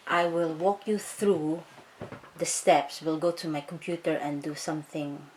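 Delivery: ordinary talk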